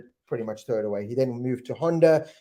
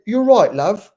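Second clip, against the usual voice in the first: higher, louder mock-British greeting; British accent; 8 semitones higher, 9 dB louder, slower than usual. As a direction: put on a chummy British accent. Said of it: "trying to do his British accent here".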